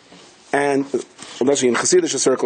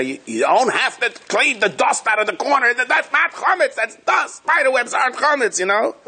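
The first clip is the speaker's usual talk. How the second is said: raised, higher, exasperated father's voice; voicing father; 10 semitones higher than usual. Father protesting to mother about cleaning dust, mock exasperated. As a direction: play the father waving off the dust.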